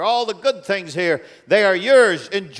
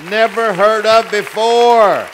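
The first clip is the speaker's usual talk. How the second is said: repeated louder, higher and slow, every word weighted; emphatic proclamation; 5 semitones higher, 9 dB louder, slower than usual. Real, not acted. Preacher to his church, proclaiming the record slow and loud. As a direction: drive it home to the congregation.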